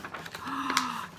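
soft, drawn-out gasp; audible intake of wonder; storyteller's gasp. Acted. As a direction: gasp quietly in pretend surprise. Storyteller reacts for suspense.

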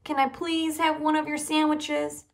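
high, loud, exasperated plea; impatient blurt. A narrator's reading. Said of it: "Buster blurted impatiently".

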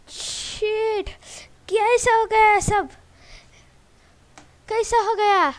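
loud, high, drawn-out cry of disbelief; shocked wail. Real, not acted. Gamer reacting to sudden disaster, shocked wail. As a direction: wail in dismay at full volume. Player just lost everything.

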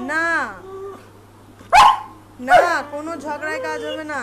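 stern loud telling-off; loud scolding. Real, not acted. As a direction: raise your voice to stop a tantrum. Mother scolding tantrum.

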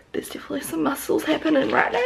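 fast, secretive whisper; whispered brag. Real, not acted. Vlogger bragging about new muscles, fast whisper.

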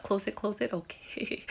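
ordinary talk, nothing marked

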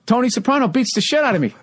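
high, eager pitch to the crowd; lightly voiced comic begging a young crowd; comic pleading with a cold room, high and eager; desperate older comic. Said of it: "He goes".

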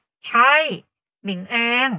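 loud, tight-mouthed answer; through the teeth. Acted. Sister stiff under a face mask, talking through teeth. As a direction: answer while barely moving the lips. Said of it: "พูดลอดไรฟันออกมาเพราะขยับปากไม่ถนัด".

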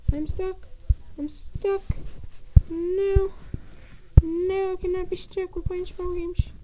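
high, rising panicked pleading; panicked whine. Real, not acted. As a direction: squeak it out in panic. Panic stuck underground.

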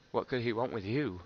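plain reading voice

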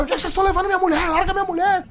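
very high, frantic mock protest; imitated outraged husband. Voices outraged husband in story. (marido indignado imitado, voz bem aguda)

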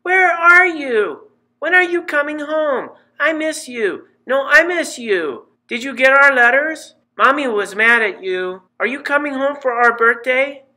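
put-on little kids tumbling over each other, high; excited six-year-olds. A narrator's reading. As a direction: squeal like small twins on the phone. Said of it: "screaming, giggling six-year-olds".

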